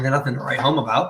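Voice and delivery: usual speaking voice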